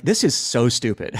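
chuckling through the words; laughing. Retold or imitated. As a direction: laugh while you say it. Laughing at himself, laugh heard.

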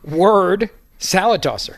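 "word", then "salad tosser", slowly with pauses; deliberate pause after "word", slow delivery; spaced-out emphasis. Shown as a demonstration. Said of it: "correct emphasis on those".

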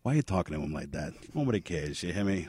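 low, soft tough-guy De Niro; quiet intimidation, staring him down; De Niro impression. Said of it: "Mister De Niro?".

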